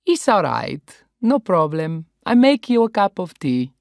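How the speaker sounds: calm her down, Italian style; Italian host. Acted. Paolo soothing the grumpy grandmother, Italian accent.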